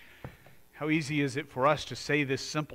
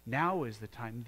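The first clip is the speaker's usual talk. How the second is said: usual speaking voice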